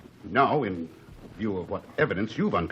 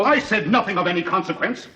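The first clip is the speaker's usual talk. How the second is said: loud, high denial; heated denial; 8 semitones higher, 4 dB louder than usual. Acted. Cornered witness denying to the lawyer, loud and high. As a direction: fire the denial back.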